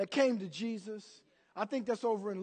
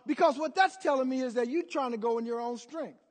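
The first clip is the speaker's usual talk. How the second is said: ordinary delivery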